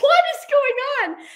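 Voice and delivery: high, drawn-out mock alarm; startled disbelief. Wife startled by husband's sudden outburst, mock alarm. Her startled reaction, retold.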